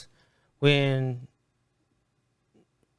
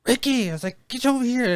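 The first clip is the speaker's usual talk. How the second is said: mock coach yell; 9 semitones higher than usual. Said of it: "whenever he messed up".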